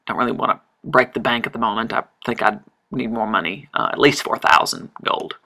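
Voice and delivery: normal talking voice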